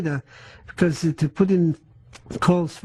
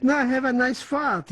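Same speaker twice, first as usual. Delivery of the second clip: a hint of a sneering guard, higher and slow; mocking guard; 7 semitones higher, slower than usual. Survivor imitating the guard's slow, high jeer at the victim. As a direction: lightly mimic the guard jeering at the scalded man, slow. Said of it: "sort of like this".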